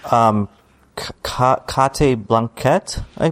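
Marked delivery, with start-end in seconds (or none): 1.9-3.0 KATE BLANCHETT mangled as "kah-tay blahn-kett"; mock mispronunciation. Co-host feigning doubt over a star's name, mock mispronounced. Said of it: "is how you say it".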